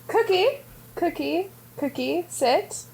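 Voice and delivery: bright rising call to a dog; calling a puppy. Real, not acted. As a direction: coax a puppy into obeying.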